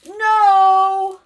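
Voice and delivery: one high, loud, held word; calling out an answer. Acted. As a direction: shout it like a roomful of children. Voicing children's shouted answer.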